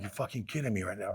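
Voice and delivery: very soft, low retold disbelief; hushed, low-pitched incredulity; stunned disbelief. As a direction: say it under your breath, as if stunned by bad news. Reacting to losing all his money.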